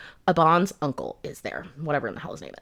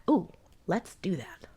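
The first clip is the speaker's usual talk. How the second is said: slow breathy whisper, softer; whispered eagerness; 6 dB quieter than usual. Retold or imitated. Heroine reacting to the demon-killing blade in a mock whisper. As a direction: lean in, conspiratorial.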